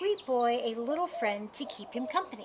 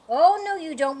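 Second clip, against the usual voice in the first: playful loud call; 4 semitones higher, 9 dB louder than usual. Acted. Playful chase with the cat.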